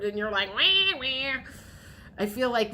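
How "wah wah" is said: higher cartoonish mock crying; mock baby wail. Viewer imitated as a cartoon baby crying, mock wail. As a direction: fake a whiny baby's wah-wah. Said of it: "a cartoon baby crying".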